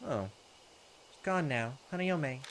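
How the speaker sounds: ordinary delivery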